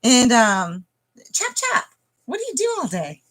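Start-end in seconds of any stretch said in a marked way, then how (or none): 1.3-3.2 high, teasing nag; joking high-pitched scolding; mock nagging. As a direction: nag them in a high, playful voice. Jokingly nags someone to hurry.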